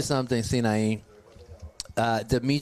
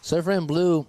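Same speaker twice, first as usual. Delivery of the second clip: usual speaking voice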